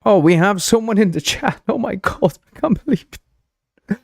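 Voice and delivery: high, astonished gasp of delight; thrilled someone joined the chat; surprised squeal. Real, not acted. Streamer surprised to see a viewer in chat, squealing high.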